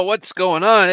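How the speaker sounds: ordinary talk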